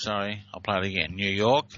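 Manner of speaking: ordinary talk, nothing marked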